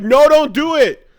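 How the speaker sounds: high, loud, drawn-out warning; exaggerated plea to a movie character; mock pleading. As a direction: cry out to stop someone.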